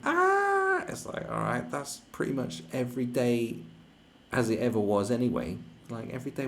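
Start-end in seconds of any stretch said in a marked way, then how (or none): 0.0-0.8 short high mock shriek of fright; mock scream. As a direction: squeal like a scared wimp, pitched way up.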